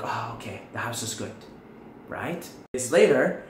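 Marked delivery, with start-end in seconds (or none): 0.0-1.3 relieved. Pretend. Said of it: "And I'm like".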